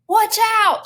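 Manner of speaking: high, urgent shouted warning. A narrator's reading. Flora warns through the window, shouted. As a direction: shout it high to someone in danger. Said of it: "she shouted".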